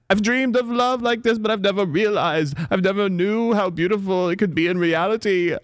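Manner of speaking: high, swooning British stage voice; rapturous and high, ending in a gasp; melodramatic declamation. Acted. Actress reliving her love speech, swooning high.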